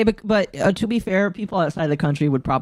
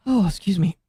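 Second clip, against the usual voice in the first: ordinary talk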